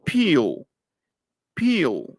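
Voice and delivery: peel with a strong puff of breath after the p; aspirated p. Shown as a demonstration. Teacher modelling peel for the students. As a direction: let the air burst out on peel. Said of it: "Traten de pronunciarla así".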